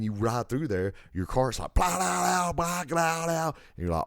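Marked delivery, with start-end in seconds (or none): 1.7-3.5 high rattling blah noises of a cart over rough floor; bumpy cart rattle. Retold or imitated. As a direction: rattle it out like wheels on bumps.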